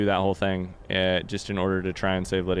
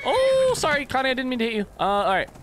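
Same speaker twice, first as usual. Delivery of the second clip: much higher, sing-song apology to the dog; high New-York-less baby-talk apology to Connie; squeaky sorry voice; 4 dB louder than usual. Pretend. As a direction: squeak out a sorry like talking to a pet.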